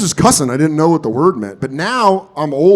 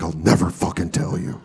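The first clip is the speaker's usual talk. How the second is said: low, soft refusal; deadpan refusal; 10 semitones lower, 5 dB quieter than usual. Retold or imitated. Eight-year-old stonewalls his friend's dad, low and quiet. Kid answers sad dad, unmoved.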